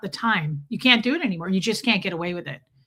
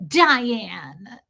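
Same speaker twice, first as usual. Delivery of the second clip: answering as herself; 4 dB louder than usual. Retold or imitated. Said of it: "I was like".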